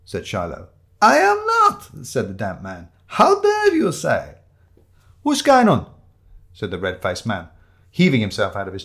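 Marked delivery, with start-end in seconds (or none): 1.0-2.1 very high, loud, drawn-out protest; indignant denial. Acted. Damp man denies the accusation. 3.1-4.4 high, loud, slow indignation; outraged. Acted. Damp man takes offence. 5.2-5.9 loud, high challenge; belligerent interruption. Acted. Red-faced man barges into quarrel.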